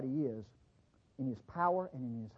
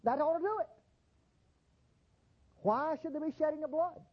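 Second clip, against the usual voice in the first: lightly voiced skeptic, rising higher at the end; 9 semitones higher than usual. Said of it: "He said".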